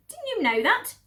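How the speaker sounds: high, loud mocking question; scornful mistress. Lady upstairs mocks the cellar woman, high and loud. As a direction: sneer the question down. Mrs Bean voice.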